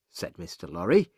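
plain reading voice

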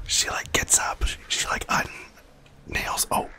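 hushed game whisper; leaning in to whisper. Real, not acted. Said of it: "get my whisper voice on".

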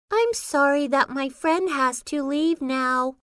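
ordinary talk

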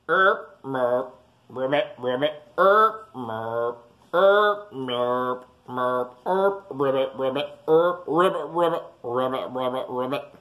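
frog croaks. Acted. Frogs singing in the swamp at night, playful croaks.

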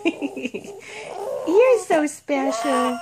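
slow and soft through laughter; laughing. Real, not acted. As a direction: laugh gently as you speak. Mother laughing with baby.

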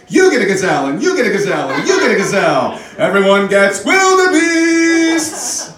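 high, loud giveaway holler; talk-show host hyping the crowd, building to a long sung-out shriek; loud, high and ecstatic, stretching the last word; Oprah. Acted. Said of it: "then they got Oprah".

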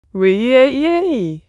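drawn-out whee swooping high, then dropping; playful whee. Acted. VTuber playing to her stream chat, drawn-out rising whoop. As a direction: let out a long rising squeal of "whee".

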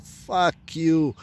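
loud, high retold yell at the medics; angry shout. Drunk man refusing the ambulance shouts at the medics. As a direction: spit it out like a furious drunk refusing help.

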